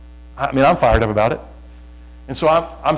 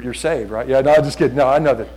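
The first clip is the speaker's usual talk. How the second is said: ordinary delivery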